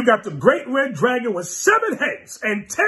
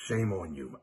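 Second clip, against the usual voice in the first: low rebuke; 13 semitones lower, 13 dB quieter than usual. Real, not acted. Preacher rebukes listeners.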